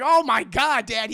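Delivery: high, loud appalled groan of words. Retold or imitated. Son learns of father's fling, appalled cry. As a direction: exclaim it loudly, scandalised.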